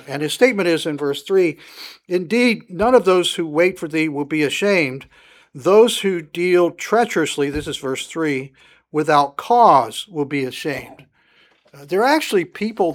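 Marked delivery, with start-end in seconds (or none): 2.1-7.5 raised, louder scripture reading. Acted. Preacher reads Psalm twenty-five aloud, solemn reading. 8.9-11.1 raised, louder scripture reading. Acted. Preacher reads Psalm twenty-five aloud, solemn reading.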